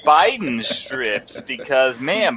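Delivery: badly faked impression of a griping cartoonist pal; George. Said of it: "your George impression sucks".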